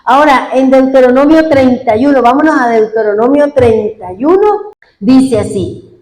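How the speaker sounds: ordinary delivery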